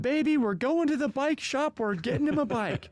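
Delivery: high-pitched call across the house; retold excited yell. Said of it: "And I yell across the house".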